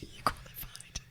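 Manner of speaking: hushed, voiceless whisper; very soft whispered repeat; whispered question. Acted. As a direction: whisper it under your breath. Question repeated under breath.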